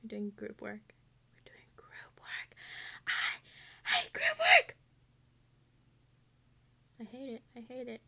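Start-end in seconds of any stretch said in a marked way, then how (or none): 1.3-2.5 slow, much softer whisper; horrified whisper. Real, not acted. Repeats news in horror. 3.1-4.8 much higher, louder, slow drawn-out wail; hammed-up cry over group work; mock wail.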